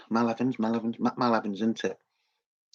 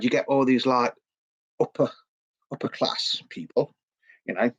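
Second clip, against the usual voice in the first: normal talking voice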